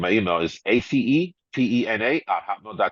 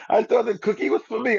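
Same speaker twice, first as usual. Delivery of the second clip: high, put-on voice for his friend; playing his friend who thinks the cookie was hers; high mock friend; 14 semitones higher than usual. Friend Curly thinks the cookie was hers, in a high mock voice.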